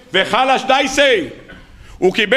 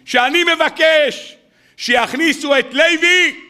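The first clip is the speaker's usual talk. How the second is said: slow, higher, drawn-out plea; pleading request; 7 semitones higher, slower than usual. Acted. Shmuel begs his father to get Levi admitted, slow and higher. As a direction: stretch each word as an earnest plea.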